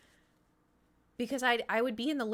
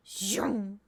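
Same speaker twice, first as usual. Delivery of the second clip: whooshing "zoom" of a speeding cat; imitated zooming noise. Streamer mimicking her cat racing down the hallway, imitated zoom. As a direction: make a quick zoom sound effect.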